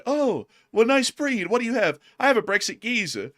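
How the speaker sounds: high-pitched, admiring small talk; alien pet owner. Acted. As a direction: coo over somebody else's pet. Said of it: "one of them's gonna be".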